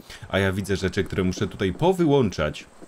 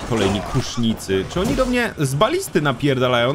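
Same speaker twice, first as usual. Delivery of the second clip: ordinary talk, nothing marked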